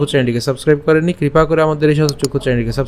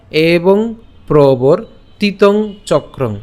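slow, separated word by word; deliberate syllable-group demo with short breaks; broken-up pronunciation; 3 semitones higher, much slower than usual. Shown as a demonstration. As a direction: teach it in small drawn-out pieces. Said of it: "উচ্চারণটা একটু ভেঙে দিয়েছি".

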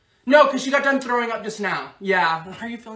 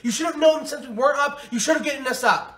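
angry yelling; 6 semitones higher than usual. Acted. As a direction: chew him out at full volume. Yelling at friend who overslept.

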